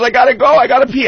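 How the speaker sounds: put-on high, urgent little-girl voice; little daughter. Dad imitates his small daughter begging to pee, high and urgent. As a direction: play the toddler who needs to pee now.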